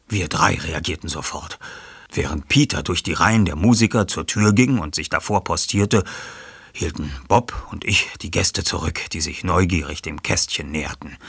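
plain reading voice

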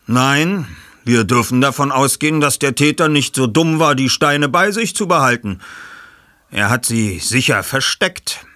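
erklärender Ermittler. Acted. Ich-Figur erklärt.

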